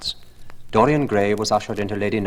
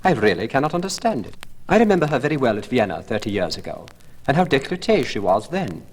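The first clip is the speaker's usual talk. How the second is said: put-on higher, louder gossiping old lady, x1.4 in the middle; scandalised old lady; 6 semitones higher, 4 dB louder, faster than usual. A narrator's reading. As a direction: relish the old scandal like a catty hostess. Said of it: "exclaimed the old lady".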